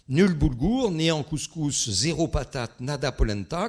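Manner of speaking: loud, measured list of clues; quiz clue announcement. Shown as a demonstration. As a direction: proclaim each pair to the whole room. Calls out riddle clues to audience.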